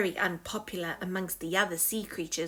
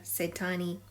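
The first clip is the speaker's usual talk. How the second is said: ordinary delivery, nothing marked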